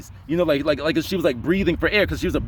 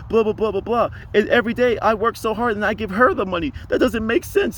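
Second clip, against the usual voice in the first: imitated fed-up woman; 5 semitones higher than usual. Passenger venting about job and wife, high imitated exasperation. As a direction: pitch it up as an exasperated complainer. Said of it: "she's like".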